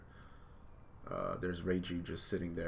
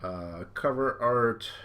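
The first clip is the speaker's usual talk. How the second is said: usual speaking voice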